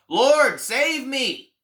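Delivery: high, loud desperate cry for help. Acted. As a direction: cry out as you sink. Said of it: "Peter cries out".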